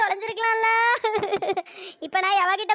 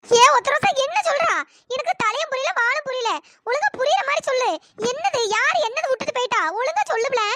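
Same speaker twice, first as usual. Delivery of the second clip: alarmed call; 4 semitones higher than usual. Acted. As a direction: shout her name in fright, then press her. Alarmed neighbour demands the news. (பயத்துடன் உரக்கக் கத்திக் கேட்பது)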